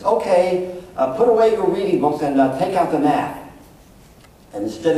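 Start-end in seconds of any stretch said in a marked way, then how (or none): 0.0-3.4 put-on brisk, higher classroom call to the kids; teacher's instruction. Teacher addressing third graders, brisk and raised. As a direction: tell the class to switch books. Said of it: "you watch the teacher say something like this".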